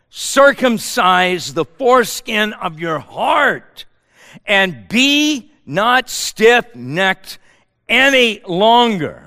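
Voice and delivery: slow, weighty verse reading; slow, solemn proclamation; slow scripture reading. Acted. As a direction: draw out circumcise the foreskin of your heart. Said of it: "quoted verse, slow".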